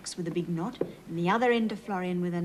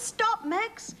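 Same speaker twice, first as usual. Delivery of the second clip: high, raised call to the tractor; farmer's call; 10 semitones higher than usual. A narrator's reading. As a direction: call out to stop him. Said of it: "shouted Farmer Klaus".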